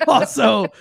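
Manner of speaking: loud, high word through a laugh. Real, not acted. Host repeats the co-host's correction, laughing. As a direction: laugh as you echo the joke.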